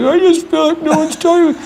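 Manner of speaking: high, far-off whimpering complaint, on the edge of tears; sensitive crier. Retold or imitated. Mocking sensitive Sam at the office, in a high teary whine. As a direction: play the weepy coworker who feels ignored. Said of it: "he's a crier".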